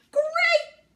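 very high, loud burst; excited bark. A narrator's reading. Dog cheered by news of wind, barking. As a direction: bark it out with delight. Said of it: "Dog barked".

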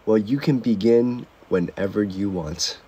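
low, soft, slow therapist voice; a gentle hushed counselor, lightly put on; mimicked therapist.

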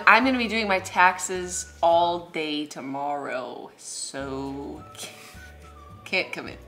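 ordinary talk

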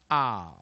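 loud "ow" sliding down from ah to oo; diphthong ow. Shown as a demonstration. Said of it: "一个念". (ow 大声念出双元音滑动)

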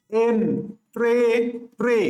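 এন, pray, pray as separate beats with pauses; teacher sounding out এন, pray, pray in chunks; broken into syllables. Shown as a demonstration. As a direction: say এন, pray, pray one syllable at a time. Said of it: "আমরা এইভাবে ভাঙবো".

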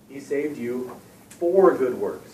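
slow "He saved you for good works", leaning on "for"; stress on FOR. Shown as a demonstration. Teacher driving the point home, slow stressed "for". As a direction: spell it out slowly, weight on "for". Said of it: "when you stress that word".

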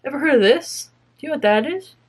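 questions lifted high, as if hailing passersby; pretend street interviewer. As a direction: accost strangers like a mock TV interviewer. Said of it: "go to people in public and be like".

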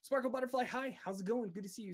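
high bright greeting to a viewer; excited greeting. Real, not acted. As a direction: welcome them like a streamer.

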